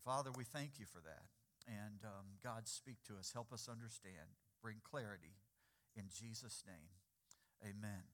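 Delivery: low, soft closing prayer. Real, not acted. Pastor asking God for clarity before preaching, hushed prayer. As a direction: pray quietly, pitch and volume dropped.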